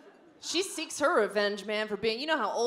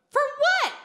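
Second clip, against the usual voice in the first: outraged squeak; 7 dB louder than usual. Retold or imitated. Outraged retort.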